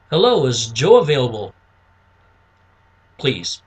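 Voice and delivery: low, subdued phone request; low-key caller mirroring prospect. Shown as a demonstration. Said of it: "you wanna relate to them".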